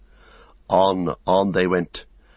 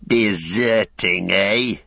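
slow, snarled accusation; menacing orc overseer, words drawn out; 2 semitones higher, 4 dB louder, much slower than usual. A narrator's reading. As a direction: snarl it slowly like a suspicious slave driver. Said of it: "he snarled".

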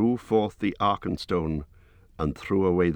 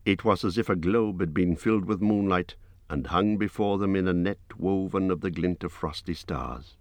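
usual speaking voice

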